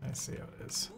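whispered, hurt and teasing; a wry whispered jab at the phone; muttered aside. Real, not acted. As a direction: breathe it out under your breath. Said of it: "looked at her phone".

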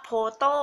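"poto" with P in place of F; wrong P reading. Shown as a demonstration. Teacher showing a misreading of photo, "poto" with a P. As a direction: pop a P to open "poto". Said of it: "จะไม่อ่านว่า".

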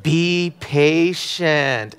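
"patient" stretched out very slowly, imitating mom; drawn-out word. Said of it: "she would say patient as though it was".

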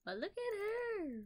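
adoring high squeal; cutesy cooing. Real, not acted. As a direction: coo over it, drawing the words out high. Cooing over a cute cat keychain.

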